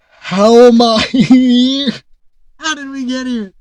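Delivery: loud, high, slow laugh-cry of disbelief; loud incredulous laugh; laughing exasperation. Real, not acted. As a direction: crack up in despair. Disbelief at coach salaries.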